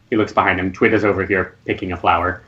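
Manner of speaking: ordinary talk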